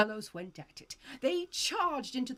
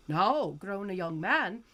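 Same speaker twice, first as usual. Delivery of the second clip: usual speaking voice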